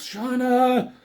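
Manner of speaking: mock yell of her name, pitched high; retold yell. Cho yelling for Shauna after she is speared. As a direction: call her name in alarm. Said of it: "He yells".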